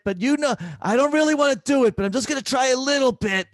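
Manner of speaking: hammed-up elderly patient bargaining; imitating a spunky old woman; feisty old lady. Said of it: "she goes".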